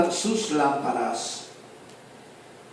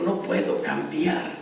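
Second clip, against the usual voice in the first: whispered weeping; 6 dB quieter, slower than usual. Retold or imitated. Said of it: "se agarró llorando y dijo".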